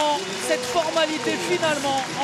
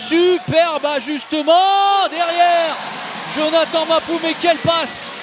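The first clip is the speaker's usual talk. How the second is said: louder, slowed-down praise; excited; 2 semitones higher, 5 dB louder than usual. Real, not acted. Praising a finish live.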